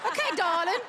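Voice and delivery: hammed-up attempt at an English accent; bad English accent. Comedian explains to British judge, bad English accent. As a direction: put on a badly faked English accent. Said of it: "I know that was a bad accent".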